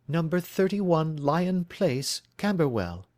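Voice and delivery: a worried bride telling her story; anxious young woman. Acted.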